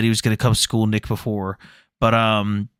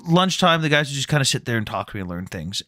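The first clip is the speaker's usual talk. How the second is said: ordinary talk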